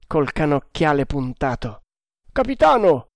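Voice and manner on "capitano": high-pitched call back; shouted answer. A narrator's reading. Lookout answering the captain from the mast, calling out. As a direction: hail the captain from far off.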